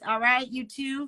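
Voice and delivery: high, stern mom checking in; a hint of a scolding mother. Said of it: "my mom voice".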